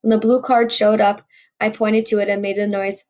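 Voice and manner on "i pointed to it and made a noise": ordinary delivery, nothing marked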